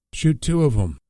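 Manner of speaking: wounded father pleading, a touch of desperation; desperate. A narrator's reading. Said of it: "Lee said in desperation".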